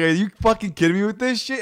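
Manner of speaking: high, loud indignant retort; outraged disbelief. Retold or imitated. Retelling the scammer speaking, loud indignant disbelief. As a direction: let the outrage spill over.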